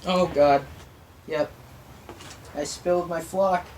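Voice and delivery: usual speaking voice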